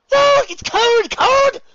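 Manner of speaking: very high, loud yelp; shocked shriek. Acted. Luna under the cold shower, shrieking. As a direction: shriek as if doused in icy water.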